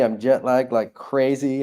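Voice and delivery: normal talking voice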